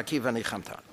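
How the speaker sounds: soft echo. Shown as a demonstration.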